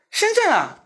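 high, incredulous uncle's voice; mock surprised driver. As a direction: scoff as the proud Shanghai driver. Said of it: "我说". (高声不屑的上海爷叔)

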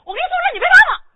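heated demand. Acted. Husband lists his holiday gifts.

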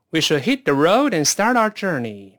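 正常语速一口气连读; 带学生连贯读完整句，不拖音; 快一点. Shown as a demonstration. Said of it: "快点试试看". (快些示范，流畅连读)

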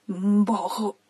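low, soft verdict after a sip; mild distaste. Acted. 狐狸大仙尝了一口, mild low distaste. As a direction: murmur it with a touch of distaste. Fox tastes the foul water.

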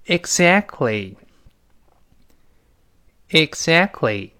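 "exactly" very slowly, silent t, falling at the end; slow read-along. Shown as a demonstration. Teacher leading another repetition, slow model. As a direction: stretch "exactly" out as a model to copy. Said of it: "我们再读一下这个词".

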